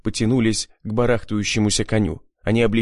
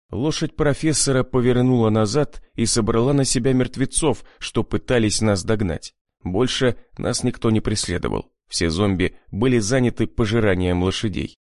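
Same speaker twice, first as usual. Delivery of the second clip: ordinary delivery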